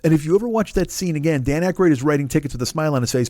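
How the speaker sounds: normal talking voice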